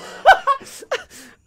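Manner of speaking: high burst of laughter; loud high cackle. Real, not acted. Guest laughs hard.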